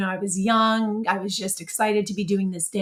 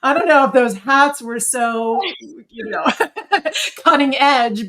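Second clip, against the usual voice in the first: laughing through it, a bit louder and higher; 5 semitones higher, 6 dB louder than usual. Real, not acted. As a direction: giggle at how silly the hats were. Laughing at the silly hats.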